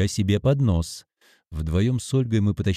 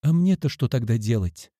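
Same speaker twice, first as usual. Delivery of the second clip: ordinary delivery, nothing marked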